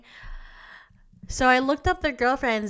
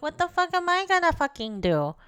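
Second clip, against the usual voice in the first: frustrated late-night self; 8 semitones higher than usual. Retold or imitated. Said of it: "So I'm like".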